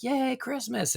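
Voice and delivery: high-pitched little "yay"; mock excitement. Host acting out looking forward to Christmas, mock excitement. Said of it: "then I'm like".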